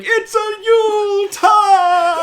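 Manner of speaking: high, slow comic yell; yelling Tick. Retold or imitated. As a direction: ham up a panicked superhero yell. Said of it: "he sprints away yelling".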